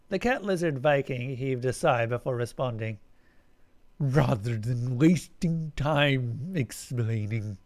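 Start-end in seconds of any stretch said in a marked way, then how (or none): 4.0-7.6 slow, tired alien drawl; the alien, sick of it, speaks slowly; weary alien voice. Acted. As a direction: sound worn out by explaining it all.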